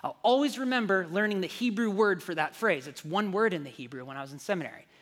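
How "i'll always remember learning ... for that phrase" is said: ordinary talk, nothing marked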